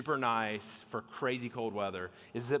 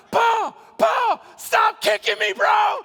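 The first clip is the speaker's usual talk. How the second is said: high, very loud pleading shout; frantic yelling; 13 dB louder, slower than usual. Retold or imitated. Man kicked in the head by his tentmate, frantic shouting. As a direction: yell to wake him and stop him.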